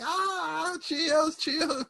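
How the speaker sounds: very high, squealed mock cheer; hammed-up ecstatic crowd squeal; everyone cheering. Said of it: "And everyone's like".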